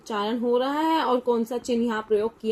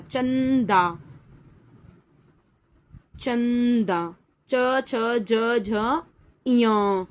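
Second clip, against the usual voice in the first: slow recitation; much slower than usual. Shown as a demonstration. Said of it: "चंदा देखते हैं एक बार".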